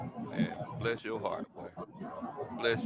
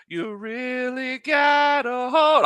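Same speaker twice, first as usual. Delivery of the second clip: mimicking voice; 8 semitones higher, much slower than usual.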